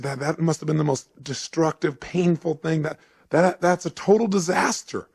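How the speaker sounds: rising, mounting dismay, imitated; appalled reaction. Costner's appalled reaction, retold.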